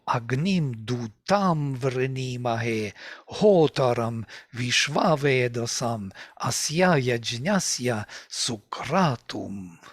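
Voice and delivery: each udatta syllable punched, as in agNIM duTAM; udatta stress. Shown as a demonstration. Teacher shows where the accent should fall, stressed recitation. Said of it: "I know where the accent should fall".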